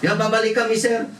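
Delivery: mock the parent's nerve; mock brazen parent.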